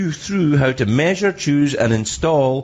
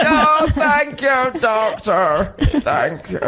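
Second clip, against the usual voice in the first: high, slow, pained thanks; hammed-up post-extraction patient; mock grateful patient; 10 semitones higher, much slower than usual. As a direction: moan your thanks through a sore mouth. Playing patient after tooth is pulled.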